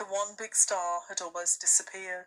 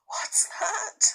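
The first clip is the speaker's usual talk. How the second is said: high, startled question; frightened; 13 semitones higher than usual. A narrator's reading. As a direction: gasp out the question in fear. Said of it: "clutching Susan's arm".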